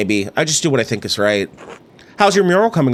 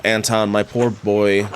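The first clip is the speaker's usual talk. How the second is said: ordinary delivery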